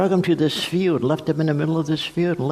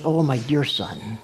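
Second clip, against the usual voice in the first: tender father. Shown as a demonstration. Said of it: "Teknon means".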